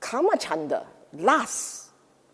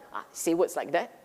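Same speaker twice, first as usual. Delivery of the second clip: ordinary delivery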